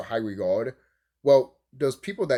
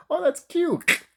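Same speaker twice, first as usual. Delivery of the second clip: much higher, cutesy tone; mock sweet voice; slower than usual. Remembering watching as a kid, cooed high. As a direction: coo it like a child. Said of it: "and I'm like".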